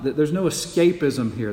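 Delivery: normal talking voice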